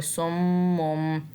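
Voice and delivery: सुम्मुन with a nasal hum held on म्म; ghunna. Shown as a demonstration. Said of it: "मीम से गुन्ना करते हुए". (सुम्मुन में म्म पर गुन्ना)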